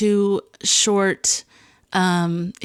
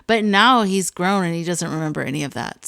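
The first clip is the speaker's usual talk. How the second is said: ordinary talk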